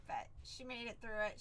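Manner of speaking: ordinary talk, nothing marked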